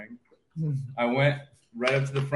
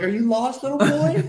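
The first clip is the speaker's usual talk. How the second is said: high, louder talking-down voice; condescending gym staff; 5 dB louder than usual. Retold or imitated. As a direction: talk to him like a lost child. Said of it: "They're like".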